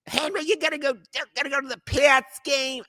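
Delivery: high-pitched, mocking Boston sports fan; Boston accent impression. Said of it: "I was doing a Boston accent".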